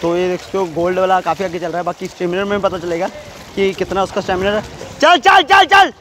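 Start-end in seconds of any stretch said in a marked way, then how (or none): 5.0-5.9 high loud shouted cheer. Real, not acted. Cheering Chintu during the 200 metres, loud shout. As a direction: yell Chintu down the pool.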